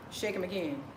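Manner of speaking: ordinary talk, nothing marked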